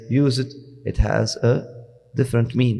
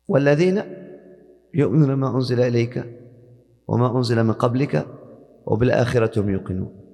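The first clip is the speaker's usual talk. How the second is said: Quran recitation; much faster than usual. Shown as a demonstration. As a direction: lift the recitation up and project it. Teacher recites the passage again.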